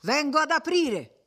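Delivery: high-pitched call back. A narrator's reading. Sexton answers the crowd at the door, calling out. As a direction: call out that you're coming.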